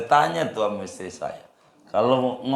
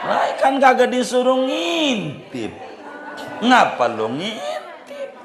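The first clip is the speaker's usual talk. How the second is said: mock scolding; 12 semitones higher than usual. Preacher scolds a nosy reader.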